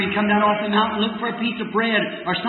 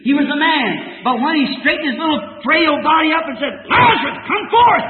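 raised, ringing preacher's cry over music; fervent pulpit proclamation; preaching at full force; 6 semitones higher, 5 dB louder than usual. Acted. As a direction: lift the scripture high like a revival sermon.

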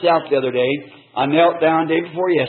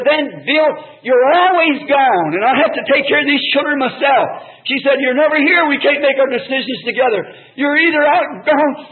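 high, loud, snappy complaint; snappy retort; 9 semitones higher, 3 dB louder than usual. Retold or imitated. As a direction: snap at him, imitating an upset wife. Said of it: "said something to me snappy".

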